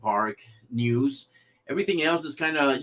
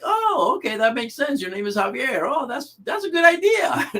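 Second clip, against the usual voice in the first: very high-pitched imitated "aha"; exaggerated, high-voiced delighted understanding; mock realization; 11 semitones higher than usual. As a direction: squeal it like someone who just got it. Imitates viewers suddenly understanding.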